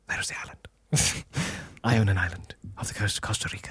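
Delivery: hushed, whispered aside; mock-secretive whisper. Host confiding about owning an island, whispered.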